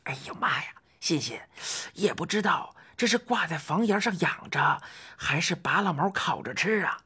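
put-on worried old man's whisper; hushed, husky stage whisper to a companion. A narrator's reading. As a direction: whisper it so the captors won't hear. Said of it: "怪老头悄声地说".

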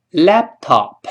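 laptop with the p held, lips closed, no burst; slow demo of laptop with a held p; lost plosion. Shown as a demonstration. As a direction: close the lips on p in laptop, then go straight into top. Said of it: "跟老师慢慢读".

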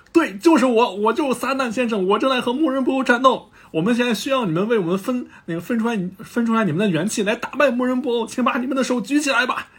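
high-pitched rousing proclamation; Mr. Satan. Retold or imitated. Satan asking Earth to raise hands, rousing appeal.

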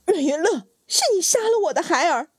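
high, crying accusation; shrill scream. Acted. Mother screaming at her sister who killed her children, high. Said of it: "尖声大叫".

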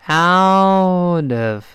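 "out of" stretched out, each sound held; very slow. Shown as a demonstration. Teacher helping students who cannot say it, very slow. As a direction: drag "out of" out slowly. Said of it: "我说慢".

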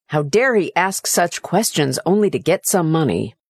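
usual speaking voice

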